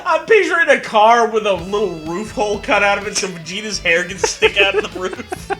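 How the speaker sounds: normal talking voice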